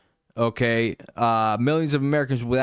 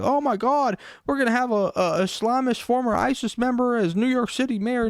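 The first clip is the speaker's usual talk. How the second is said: a much higher, hammed-up panicky mock voice; mocking Middle America; 11 semitones higher than usual. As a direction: squeal it like a frightened parody of Middle America. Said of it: "Middle America".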